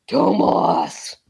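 a drawn-out, deep call of the name; deep Sasquatch voice. Retold or imitated. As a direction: mimic a big creature calling out. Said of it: "this big, deep voice saying".

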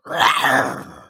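drawn-out roar like an attacking cat; snarling big-cat roar; tiger roar. Shown as a demonstration. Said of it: "difference between a lion roar and a tiger roar".

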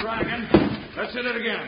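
strained shout while heaving; heave ho pulling. Acted. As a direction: heave the weight and yell. Swinging beef as battering ram.

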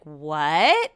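what, swooping up sharply at the end; retold disbelief. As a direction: retell a stunned question with a rising lilt. Retelling her stunned reply to news.

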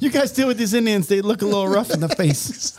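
high, dismissive parting line; mock settler voice. A settler warns the others about rough locals, high and dismissive. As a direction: say it high and carelessly. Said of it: "settler quote repeated".

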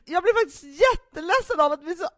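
high, loud hurt-mother plea, half laughing; guilt-trip the child like Mom would; sounding like our mother. Retold or imitated. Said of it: "jag är Stina Stjernberg, vår mamma".

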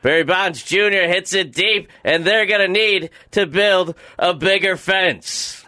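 shouted play-by-play home run call. Pretend. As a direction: call it like a minor league announcer. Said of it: "That's a great homer call".

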